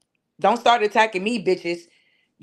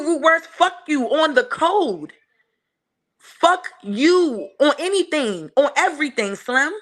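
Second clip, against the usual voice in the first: slow insult; 4 semitones higher, slower than usual. Real, not acted. Slow, deliberate insult to rival.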